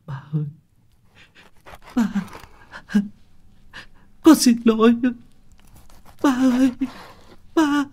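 high, sobbing, broken voice; whimpering apology. Acted. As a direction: cry out to him through tears. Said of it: "miệng nó mếu máo".

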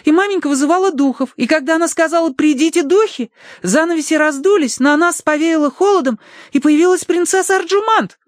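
very high, piping excited account; girlish daughter, excited. Acted. Daughter recounts the seance to papa, excited.

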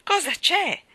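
high, loud, exasperated question; irritated. A narrator's reading. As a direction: snap it at the pestering child. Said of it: "chiese irritata".

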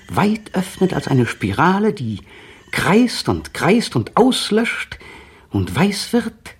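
rising ecstasy. Acted. Caught in the howling siren spiral, voice soaring in rapture. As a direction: let the voice climb as if pulled into a whirl.

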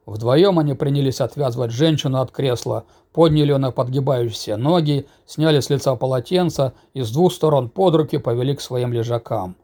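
usual speaking voice

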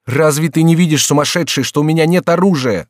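high, loud exasperation; urgent shouted question; shouting. A narrator's reading. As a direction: shout in disbelief. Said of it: "закричал Генрих".

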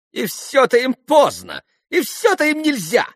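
very high, loud complaint; almost shriek with exasperation; vexed near-squeal. A narrator's reading. Grushenka fed up with the gentlemen, squealing in vexation. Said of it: "почти взвизгнула в досаде Грушенька".

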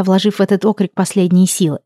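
ordinary delivery

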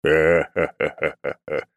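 slow, measured ha-ha-ha; slow belly laugh. Acted. God laughs at a compliment, slow and hearty. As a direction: laugh slowly, one beat at a time.

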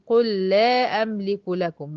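slow قل لا with one held lam; "qul-la amliku lakum" drawn out; doubled lam. Shown as a demonstration. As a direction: join the words, lam doubled. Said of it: "وصلت الكلمتين ببعض".

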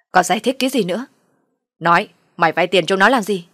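father's angry outburst; angry interrogation. Acted. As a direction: cut him off and demand an answer. Angry father interrogates his son.

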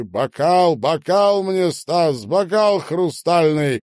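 high, loud calling out; loud demand. A narrator's reading. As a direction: demand it grandly at full voice. Drunken singer demands a glass.

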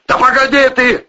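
loud, high, irritated bark. A narrator's reading. Man silencing the girl in the pit, barking. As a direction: snap at her loudly. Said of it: "рявкнул Протасов".

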